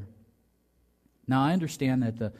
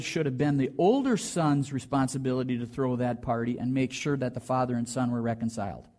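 ordinary talk

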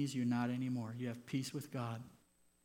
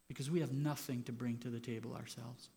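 ordinary talk

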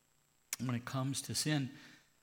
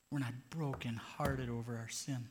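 usual speaking voice

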